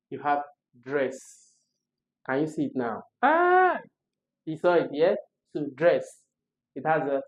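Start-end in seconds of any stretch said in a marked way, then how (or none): 3.2-3.8 loud, high-pitched "aah" of discovery; sudden high squeal of realisation; high excited "ah". Pretend. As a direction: cry out a long high "ah", as if it just clicked. Teacher exclaims as answer is spotted.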